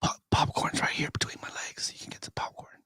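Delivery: hushed suggestive whisper; movie theater whisper. Pretend. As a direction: whisper it close and low. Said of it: "you can whisper in the movies".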